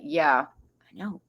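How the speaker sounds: ordinary delivery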